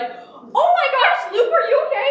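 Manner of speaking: high, loud, stretched-out alarm; hammed-up frantic mom fussing over her kid; mock panicked mother. Mom coming home to her injured son, mock panic.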